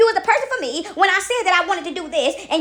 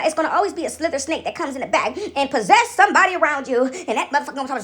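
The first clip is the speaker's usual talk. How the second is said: normal talking voice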